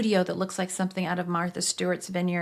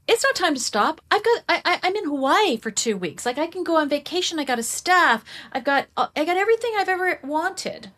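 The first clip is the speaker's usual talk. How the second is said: high, loud protest; 9 semitones higher, 4 dB louder than usual. Retold or imitated. Arguing back at the voice on a Hawaii beach, high and loud. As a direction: pitch jumps way up, protesting loudly.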